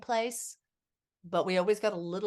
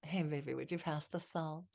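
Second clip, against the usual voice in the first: tender request; 3 semitones lower, 9 dB quieter than usual. Retold or imitated. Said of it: "he'd say".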